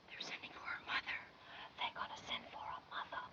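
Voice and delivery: hushed whisper passing on news; shocked whisper. Acted. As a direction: whisper the news along secretly. Onlookers pass the news in whispers.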